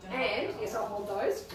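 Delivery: ordinary talk, nothing marked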